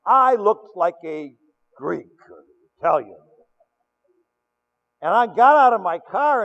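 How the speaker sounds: ordinary delivery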